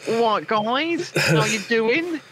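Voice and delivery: slow, drawn-out mock southern English greeting; mock southern English accent. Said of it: "Just go".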